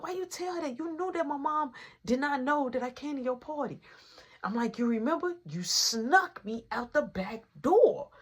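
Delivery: high-pitched exasperated reproach; retold accusation.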